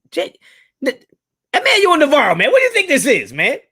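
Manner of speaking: high, loud mock scolding; mock exasperation. Real, not acted. Host calls out a commenter for his question, mock exasperated. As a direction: call him out, half joking.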